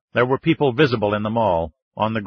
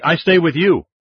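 high, loud insistence; shouted protest; 7 semitones higher, 5 dB louder than usual. A narrator's reading. Andrea insisting on staying with Ryan, shouting. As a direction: shout back, pitched up. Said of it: "she shouted back".

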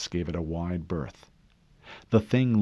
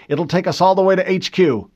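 loud, higher order; urgent order; 9 semitones higher, 8 dB louder than usual. A narrator's reading. Harlan directing Fincher along the river, loud. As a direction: tell him where to drive, firmly.

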